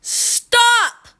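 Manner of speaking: high, drawn-out shriek; very loud yell. A narrator's reading. Girl picked up by the hair, very loud yell. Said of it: "yelled very loudly".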